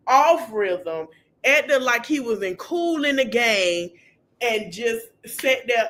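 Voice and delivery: ordinary talk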